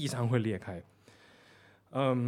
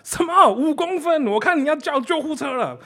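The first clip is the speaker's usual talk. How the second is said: shocked; 12 semitones higher, 9 dB louder than usual. Retold or imitated.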